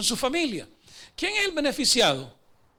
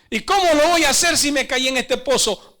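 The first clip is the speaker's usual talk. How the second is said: loud, raised voice of the man in the pit; acted indignant protest; 3 semitones higher, 6 dB louder than usual. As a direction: protest loudly, throwing the blame back. Fallen gardener protests, blaming God.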